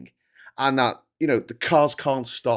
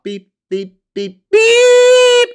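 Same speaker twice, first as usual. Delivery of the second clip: high piercing electronic beeps, the last ones much louder; imitated parking beeps; 12 dB louder, much slower than usual. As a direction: mimic the car's parking alarm beeping. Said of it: "makes a fucking noise when you try to park".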